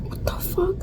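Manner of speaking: hushed, whispered reaction; whispered disbelief. Retold or imitated. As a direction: whisper it as a retold stunned aside. Retold stunned reaction to story.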